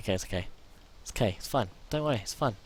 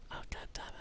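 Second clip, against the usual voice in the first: defeated whisper; 15 dB quieter, faster than usual. Real, not acted. Character died.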